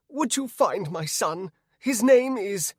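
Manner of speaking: high, urgent townswoman; searching woman. A narrator's reading. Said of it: "a woman by a fruit stand who looked to have lost something".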